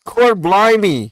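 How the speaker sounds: hammed-up British accent, high, loud and slow; mock British accent. Streamer joking back at a question about their accent, hammed-up British accent. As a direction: draw it out loudly in a put-on British accent. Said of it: "When did you get the accent".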